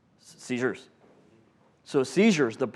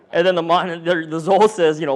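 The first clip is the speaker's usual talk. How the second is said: ordinary talk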